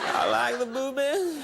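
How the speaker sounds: Bill Clinton impression. Host repeats his old president bit, slow chuckling Clinton voice. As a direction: savor it like a chuckling Southern president. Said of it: "That was my Bill Clinton impression".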